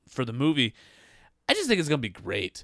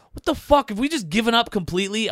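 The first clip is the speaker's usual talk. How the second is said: exasperated outburst; 8 semitones higher than usual. Real, not acted. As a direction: throw up your hands at it. Ranting about the movie title.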